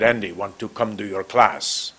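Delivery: put-on low voice with a strong West African accent; heavy West African accent. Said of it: "in a very heavy, strong accent".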